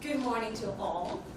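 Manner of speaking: ordinary talk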